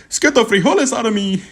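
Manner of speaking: high, hammed-up cartoonish movie line; mocking a corny line from the movie; mocking George Lopez. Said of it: "George Lopez saying".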